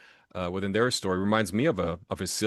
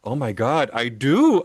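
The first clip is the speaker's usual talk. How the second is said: imitating shocked woman; 5 semitones higher, 9 dB louder, slower than usual. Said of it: "and she goes".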